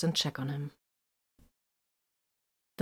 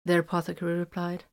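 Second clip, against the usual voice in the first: usual speaking voice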